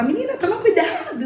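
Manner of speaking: mum's laughing mock scolding; imitating mom. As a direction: tease mom's old warning with a laugh. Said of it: "que minha mãe falava".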